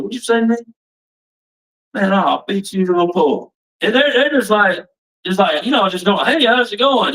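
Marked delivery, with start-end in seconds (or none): 0.0-3.5 higher-pitched, mock-combative challenge; raised pitch, squaring up for a fight; retold confrontation. Retelling his angry snapping at people. 6.3-7.1 high-pitched, chirpy mock hello; imitated casual greeting.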